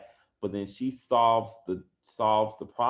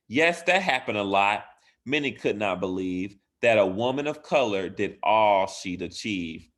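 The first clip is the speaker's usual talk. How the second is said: put-on lively, louder read-aloud for kids; reading a story aloud; 8 dB louder than usual. Teacher reading a bedtime story to her class, lively and bright. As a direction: bring the rhyming picture book to life.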